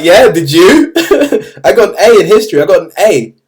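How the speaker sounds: loud, incredulous comeback breaking into a laugh; mock indignant protest. Pupil protests to the teacher who called him loser, mock indignation. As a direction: protest your grade, half laughing in disbelief.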